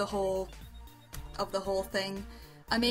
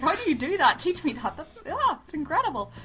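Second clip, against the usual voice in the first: higher, lively mock-envious gush; 6 semitones higher, 5 dB louder than usual. Co-host imagines begging the artist to teach her, gushing envy. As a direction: gush in playful envy, pitch jumping up and down.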